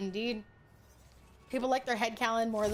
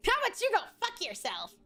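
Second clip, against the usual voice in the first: mock angry retort; 8 semitones higher than usual. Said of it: "snapping back at demand".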